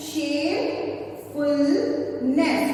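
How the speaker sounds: Shamefulness drawn out very slowly; slow syllable by syllable. Shown as a demonstration. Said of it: "When we pronounce this word".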